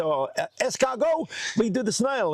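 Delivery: high, eager offer; eager reply. Retold or imitated. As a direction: pitch the next trick brightly. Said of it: "And I say".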